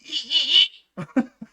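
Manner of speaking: high, loud gritty singer's rasp breaking into a laugh; wordless burst of grit, then laugh; gritty vocal demo. Shown as a demonstration. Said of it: "adding that little bit of grit".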